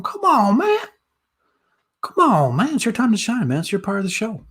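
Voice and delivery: high-pitched, playful pleading with the audience; pleading coaxing. Real, not acted. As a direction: coax them in a high, exasperated whine. Coaxing silent chat for questions.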